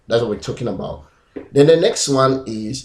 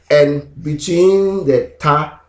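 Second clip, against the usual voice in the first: normal talking voice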